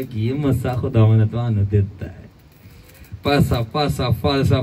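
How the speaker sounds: lightly voiced fawning Punjabi devotee, gushing praise; butter up the pastor in a light Punjabi lilt; a hint of a flattering Punjabi churchgoer; mock Punjabi flatterer.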